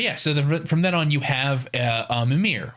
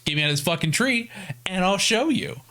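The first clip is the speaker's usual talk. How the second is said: higher, wheedling prisoner; mock Mimir; 6 semitones higher than usual. Mimir bargaining to get out of the tree, higher voice. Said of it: "And he's like".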